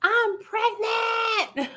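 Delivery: high, stretched-out joyful shout; excited scream. Retold or imitated. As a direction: call out the big news, thrilled. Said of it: "I was like".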